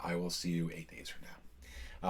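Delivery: a soft, breathy whispered joke; whispered aside. Real, not acted. Whispering a teasing goodbye to viewers who skip. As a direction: drop to a whisper for the sign-off.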